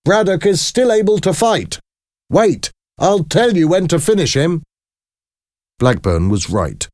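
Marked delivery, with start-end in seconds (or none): 0.0-4.6 very high-pitched urgent calling voice; shouted instruction. A narrator's reading. Trainer tells his boxer when to finish, shouted instruction. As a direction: shout high from the corner, without full volume. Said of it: "shouted Blackburn".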